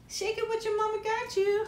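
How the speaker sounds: ordinary talk, nothing marked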